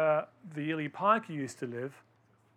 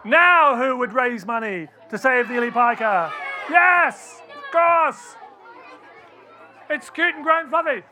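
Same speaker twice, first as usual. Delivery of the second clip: much higher and louder, hammed-up eager voice; gushing enthusiasm; 7 semitones higher, 11 dB louder than usual. Speaker to children, hammed-up gush for a fluffy animal. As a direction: overplay the gush for the cute animal. Mocking the cute-animal appeal.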